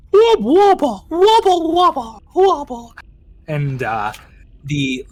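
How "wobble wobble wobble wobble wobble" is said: high, loud wobbling creature noise; creature warble. Acted. The creature warbling the message to its queen. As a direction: warble it out like a strange fish beast.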